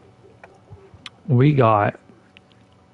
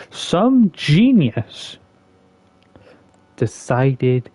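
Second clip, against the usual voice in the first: sarcastic; 3 semitones higher, much slower than usual. Real, not acted. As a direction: draw it out with scorn. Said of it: "and I'm being sarcastic".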